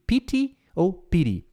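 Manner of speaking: "pity" first with a clear T, then with a tapped r sound; "pity" said two ways, hard T versus flapped T. Shown as a demonstration. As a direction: contrast "pity" and "piry". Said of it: "Então, pity".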